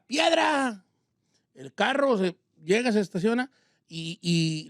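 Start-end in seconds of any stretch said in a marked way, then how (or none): 0.1-0.9 retold shout. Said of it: "y grita".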